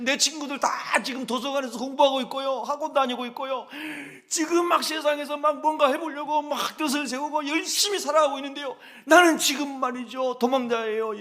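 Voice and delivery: high, loud anguished lament building to a sobbing cry; David crying out. Retold or imitated. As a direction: cry out to God, building to tears. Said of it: "울었을 것 같아요". (점점 울먹이며 터져 나오는 높고 큰 절규)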